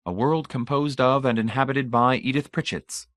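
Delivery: usual speaking voice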